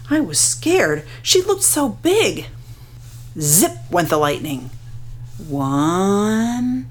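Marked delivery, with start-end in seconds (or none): none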